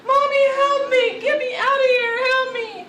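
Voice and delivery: high, loud panicked cries; mother retells son's panic to him, loud and high; imitating child screaming. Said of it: "you just started screaming".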